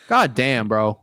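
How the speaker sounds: ordinary delivery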